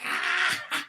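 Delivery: high mock laughing shriek; imitated cackle. Teller imitates a manager laughing at a crying salesman, high mock shriek. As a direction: mimic the manager cracking up. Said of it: "He's like".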